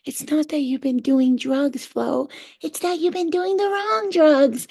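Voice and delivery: high, slow sing-song munchkin voice; little shroom creature. Retold or imitated. Little shroom guys mocking the drug taker, high munchkin voice. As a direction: tease like a tiny mushroom munchkin. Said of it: "little shroom guys came to talk to me".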